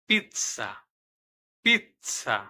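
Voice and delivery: পিৎজা with a ts sound; Italian word demo. Shown as a demonstration. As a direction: model পিৎজা with a crisp ts. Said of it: "স এর মতো".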